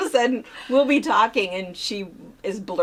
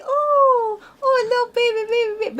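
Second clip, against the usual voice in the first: put-on much higher, cooing baby talk; sweet baby talk; 13 semitones higher, 5 dB louder than usual. Grandma imitating her granddaughter cuddling the baby, high cooing. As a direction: coo over the baby like a little girl. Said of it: "she talks so sweet".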